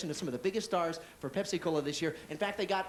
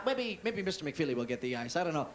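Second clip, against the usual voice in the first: ordinary talk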